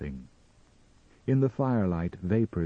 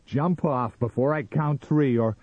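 high, loud ultimatum; loud threat; 8 semitones higher, 6 dB louder than usual. A narrator's reading. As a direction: threaten the intruder with raised voice. Sam threatens with a gun.

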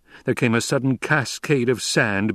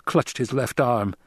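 ordinary delivery, nothing marked

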